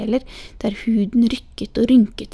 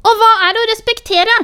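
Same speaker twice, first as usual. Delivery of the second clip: high, loud furious scream; child shrieking in anger; 12 semitones higher, 8 dB louder, faster than usual. A narrator's reading. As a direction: scream it and stamp the ground. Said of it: "skrek Sofia".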